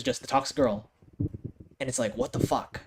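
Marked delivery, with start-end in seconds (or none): none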